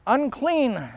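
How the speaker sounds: high, drawn-out verdict, falling at the end; priest's pronouncement. Acted. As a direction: pronounce it like a sentence handed down. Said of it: "With that pronouncement".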